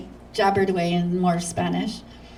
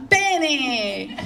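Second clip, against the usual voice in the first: higher, slow showman announcing a star, laughing through it; hammed-up MC intro; 8 semitones higher, slower than usual. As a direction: ham it up like a stage host introducing the famous guest. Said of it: "he introduces me to the audience as".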